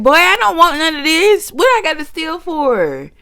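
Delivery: high, indignant protest; high mock indignation at the staring clerk; high sassy rant. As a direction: talk back at the clerk in her head.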